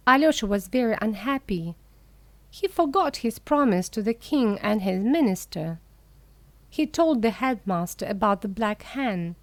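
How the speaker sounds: plain reading voice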